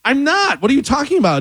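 high-pitched retold protest; indignant denial. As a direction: act out baffled indignation at an accusation. Retold quarrel with the neighbor.

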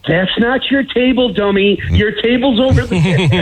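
high, slow mock scolding; Galvin impression. Picturing Galvin working as usher at the wedding, mock-bossy impression. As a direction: put on a bossy usher directing guests. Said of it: "I can see Galvin saying".

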